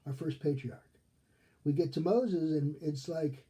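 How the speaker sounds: normal talking voice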